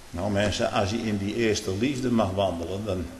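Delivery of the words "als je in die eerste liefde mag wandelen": normal talking voice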